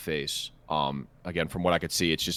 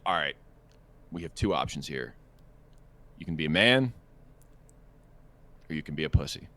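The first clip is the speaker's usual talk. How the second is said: low, softer, with long pauses; sober self-talk; 7 semitones lower, 6 dB quieter than usual. Retold or imitated. Man weighing two options before passing her, sober self-talk. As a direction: lay out the options gravely to yourself. Acts out talking himself into it.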